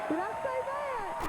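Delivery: horrified Southern belle; mock shock. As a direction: clutch pearls at your own words. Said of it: "shocked at herself".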